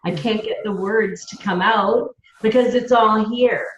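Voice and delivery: ordinary delivery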